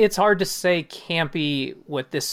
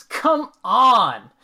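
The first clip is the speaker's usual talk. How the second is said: exasperated; 9 semitones higher, 9 dB louder than usual. Acted. Exasperated exclamation in letter.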